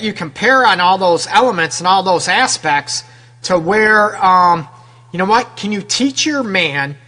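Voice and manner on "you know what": normal talking voice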